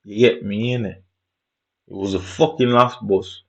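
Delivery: ordinary talk, nothing marked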